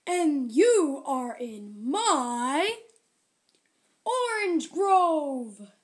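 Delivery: slow, loud, heavy ogre voice. Acted. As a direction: boom it out slowly like a big ogre.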